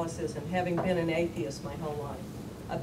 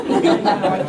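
higher and louder through laughter; laughing; 11 semitones higher, 12 dB louder than usual. Real, not acted. Admitting to the panel that she cusses, laughing loudly.

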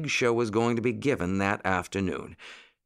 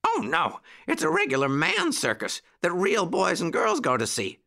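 put-on high, eager puppy-boy voice; puppy bark; 10 semitones higher than usual. A narrator's reading. Jackie corrects Uncle Wiggily about the circus, high puppy bark. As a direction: insist in a high yappy voice. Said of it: "barked Jackie".

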